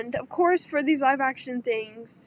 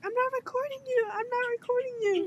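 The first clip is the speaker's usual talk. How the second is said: high, quick mock mom voice; imitating mom; 10 semitones higher, faster than usual. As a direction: rush it high, playing mom protesting. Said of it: "Mom was like".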